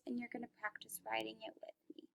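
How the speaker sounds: quick soft whisper; hushed quick aside to the class. Real, not acted. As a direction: drop to a whisper.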